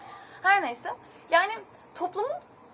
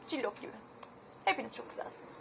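whispered, much softer compliment; 6 semitones lower, 14 dB quieter than usual. Real, not acted. Whispering a compliment to her followers, much softer. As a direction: hush it like a secret kindness.

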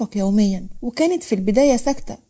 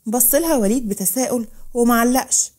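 ordinary delivery

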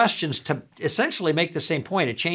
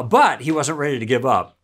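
ordinary talk, nothing marked

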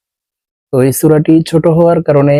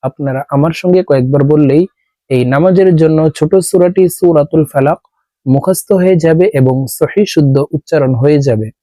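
usual speaking voice

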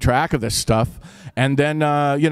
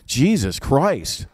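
normal talking voice

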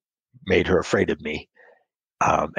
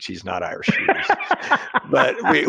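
usual speaking voice

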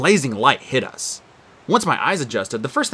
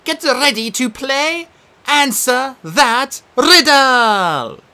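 high, loud, drawn-out showbiz announcement; game show host; 13 semitones higher, 6 dB louder, much slower than usual. Acted. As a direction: sell the game like TV. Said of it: "she announced".

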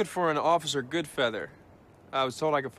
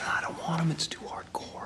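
hushed, whispered objection; reluctant whisper; 5 dB quieter than usual. Acted. Balking at the eye drops plan, reluctant whisper. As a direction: whisper your refusal under your breath.

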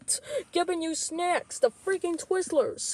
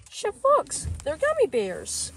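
much higher plea of his name; high protesting call; high pleading call; 6 semitones higher than usual. Acted. Junior pleads after scolding.

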